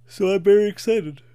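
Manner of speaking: talking through a yawn. Real, not acted. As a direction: say it mid-yawn, stretched open. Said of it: "sorry, I'm yawning a little bit".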